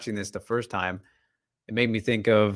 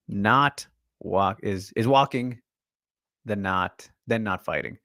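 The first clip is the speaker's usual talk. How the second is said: steady narration read; reading a script aloud; 2 semitones higher than usual. Acted. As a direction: read it like a voiceover. Reads Ghost's breakdown script.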